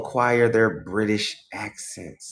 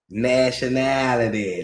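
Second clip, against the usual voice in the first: slow imitated Southern drawl; Southern accent. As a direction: stretch it out in Southern style. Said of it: "Real Southern".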